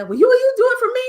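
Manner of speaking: high, loud, quick pleading ask; voicing people begging her to do it; eager request. Clients asking her to produce their show, high and eager.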